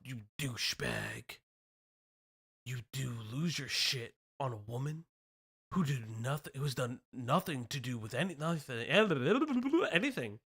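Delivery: low, hushed anonymous voice. Acted. Reads an angry post in a low, quiet anonymous voice. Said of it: "let me do an anonymous voice".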